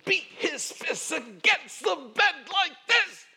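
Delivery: high, loud anguished cry, slowed; anguished outburst. Retold or imitated. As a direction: cry it out like a broken veteran pounding the bed. Pastor reenacts vet's anguished outburst.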